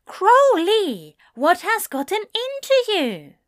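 high, loud alarmed angel; alarmed shout. Acted. Alarmed as car peels out.

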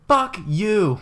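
loud, high shouted curse; shouted mock outburst. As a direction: yell it at the girl across the room. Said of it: "Like, loud".

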